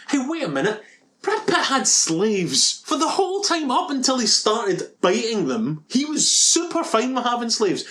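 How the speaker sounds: high-pitched comic outrage; indignant rant. Real, not acted. Podcaster objecting to a film's hero, indignant rant. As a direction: protest incredulously, voice pitched right up.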